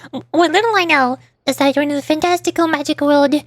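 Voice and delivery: ordinary talk, nothing marked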